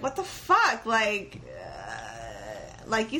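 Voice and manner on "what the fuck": high incredulous outburst; mock disbelief. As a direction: react in shock. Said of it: "you're like".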